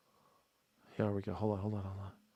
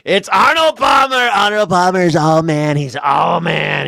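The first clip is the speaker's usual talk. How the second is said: loud mocking cheer, higher; mock shout; 4 semitones higher, 5 dB louder than usual. Streamer mocking Trump's praise of Palmer, loud mock shout. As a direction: shout the name like a gushing fan.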